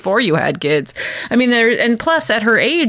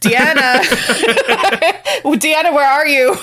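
a mock-panicked captain, cracking up; laughing mock Picard; 4 semitones higher than usual. Captain yelling for the counselor, laughing. Picard voiced calling for Deanna.

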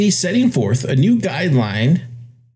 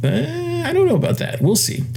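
skeptical. Acted. Skeptical aside about Fed.

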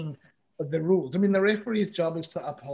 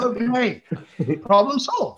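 mock breezy; 6 semitones higher, 6 dB louder, slower than usual. Imitating his flippant reply.

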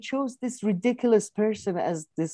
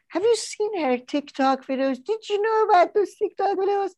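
mock senator's questioning, pitched much higher; hammed-up senator pressing the witness; grilling senator. Senator grilling the defender at the hearing, mock pushy questioning. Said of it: "the Republican one was like".

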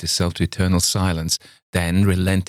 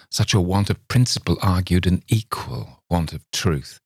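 ordinary delivery, nothing marked

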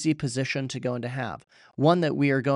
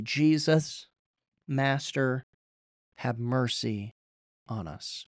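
humble plea; slower than usual. Retold or imitated. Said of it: "cried out in humility".

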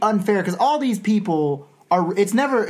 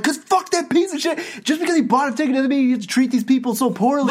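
higher, indignant outburst; retold pissed-off rant; 6 semitones higher than usual. Reader explaining why they are mad, retold indignant rant. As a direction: relive getting worked up over it. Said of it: "was, like, getting pissed".

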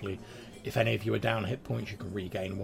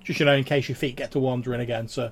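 loud, raised friendly barmaid; 4 semitones higher, 10 dB louder than usual. Acted. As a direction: reassure him loudly as the barmaid. Barmaid advises.

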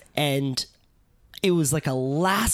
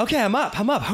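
high, breathless relief at breaking the surface; relieved surfacing; 7 semitones higher, faster than usual. Retold or imitated. Surfer relives surfacing after the hold-down, high relieved gasp. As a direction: gasp it out as you finally come up for air.

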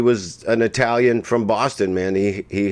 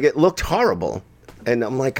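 normal talking voice

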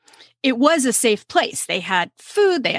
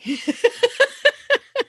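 hearty laugh; 13 semitones higher than usual. Real, not acted. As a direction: burst of high laughter. Host bursts out laughing.

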